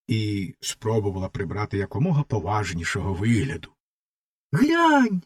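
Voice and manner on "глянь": put-on high excited boy's cry; a boy spotting something in the ditch, excited; boy's exclaim. A narrator's reading. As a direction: call out like an eager lad. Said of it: "вигукнув один із хлопців".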